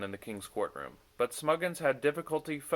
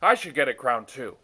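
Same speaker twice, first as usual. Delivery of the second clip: scornful; 2 semitones higher, 9 dB louder than usual. A narrator's reading. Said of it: "his voice scornful".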